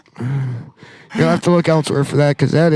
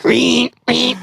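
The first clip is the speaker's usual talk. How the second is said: high squealing reen like a pig; mock pig noise reen reen; 8 semitones higher than usual. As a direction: squeal it like a piglet.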